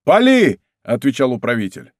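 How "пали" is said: high, loud firing command; shouted order. A narrator's reading. Steward ordering his men to fire, shouting. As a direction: bellow the order to shoot.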